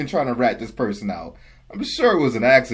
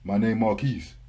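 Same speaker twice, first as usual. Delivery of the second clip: low, slurred country mumbling voice; deep country mumble; 6 semitones lower, slower than usual. Acted. Imitating a customer giving his name as Marquise, deep country mumble. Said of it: "that deep country mumbling voice".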